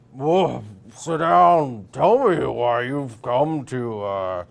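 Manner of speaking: loud talking through a mouthful; mouth full. Acted. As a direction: bellow with food in mouth. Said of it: "with a mouthful of food".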